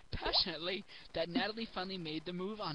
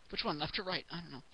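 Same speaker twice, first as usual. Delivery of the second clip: ordinary talk, nothing marked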